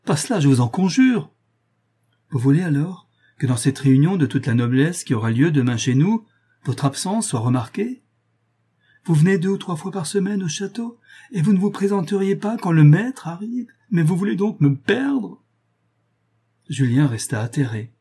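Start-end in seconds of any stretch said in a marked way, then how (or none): 0.0-1.3 high-pitched, frantic begging; horrified. A narrator's reading. Lover begs to be spared the meeting, horrified. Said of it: "dit Julien épouvanté". 2.3-15.4 high-pitched, indignant questioning; heated and animated. A narrator's reading. Woman fears his absence will ruin her, agitated. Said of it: "dit Gabriel en s'animant".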